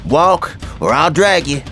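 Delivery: ordinary delivery, nothing marked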